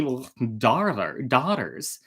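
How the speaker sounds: usual speaking voice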